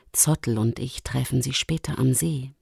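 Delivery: low, soft reply; calm Luchsin. Acted. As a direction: answer low and quietly as the lynx. Luchsin answers worried Lumi. (ruhige, tiefe, leise Antwort)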